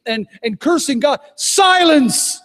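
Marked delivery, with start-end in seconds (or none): 1.4-2.4 sharp, loud, high rebuke; barked command to be silent; commanding shout. Retold or imitated. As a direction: cut the demon off with full authority. Commanding a demon in deliverance.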